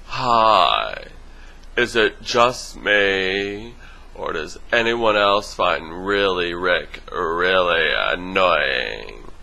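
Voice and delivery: slow, drawn-out mocking whiny voice, pitch dropping at the end; Mister Dutyhead impression. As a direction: drag it out like a bored complainer, slowly. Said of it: "let me do my best impression of Mister Dutyhead's".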